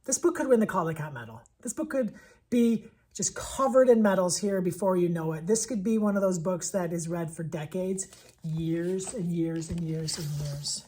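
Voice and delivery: usual speaking voice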